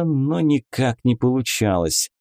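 ordinary delivery

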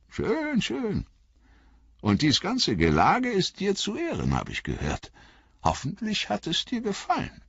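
put-on high, cheerful old hobbit; high old hobbit voice, warmly pleased; old hobbit Bilbo. A narrator's reading. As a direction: greet him like a fond old uncle.

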